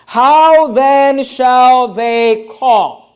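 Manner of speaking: very slow, stretched-out scripture question; drawn-out rhetorical question. Acted. Preacher putting the verse's question to the congregation, drawn out. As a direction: pose it slowly, dwelling on every word.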